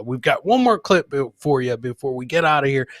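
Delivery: usual speaking voice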